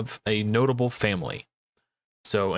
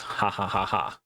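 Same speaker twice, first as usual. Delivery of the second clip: quiet breathy ha ha laugh; low amused chuckle; soft chuckle; 4 semitones lower, 4 dB quieter than usual. Real, not acted. Laughing at his own trick.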